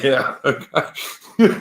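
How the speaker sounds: a real laugh, breaking into the words; chuckled, scoffing yeah okay. Real, not acted. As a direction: laugh it off, amused and dismissive. Laughing off the Russian spy accusation.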